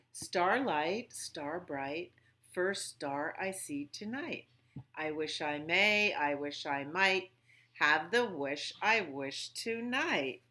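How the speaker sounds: put-on gentle sing-song rhyme for a child; nursery rhyme recital. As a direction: lilt it like a bedtime wish.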